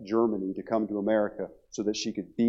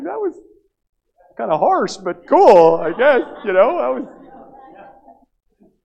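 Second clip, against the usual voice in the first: very high, loud mock bewilderment; stunned reaction; 17 dB louder, much slower than usual. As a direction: push it way up high, half-amused. His stunned reaction to overheard breakup.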